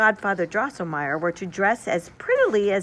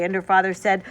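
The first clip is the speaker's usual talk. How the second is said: usual speaking voice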